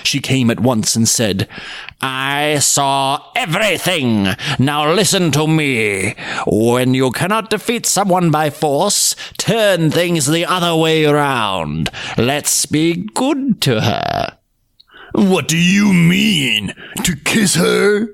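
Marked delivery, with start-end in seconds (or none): 2.0-14.4 slow, cold cunning voice; calm the children with a sly plan; scheming winter witch. Acted. 15.1-18.1 slow, mocking windy voice; ironic whirlwind. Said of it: "asked ironically".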